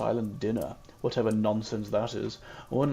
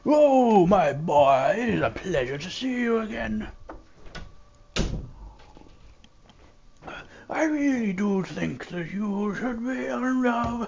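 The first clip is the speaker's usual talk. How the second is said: high, hammed-up breathy old man; breathy exasperated priest; 9 semitones higher, 3 dB louder, slower than usual. Player imitating the old priest greeting a boy, breathy and exasperated. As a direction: sigh every word out like the weary old priest. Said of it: "Like a very breathy, exasperated tone".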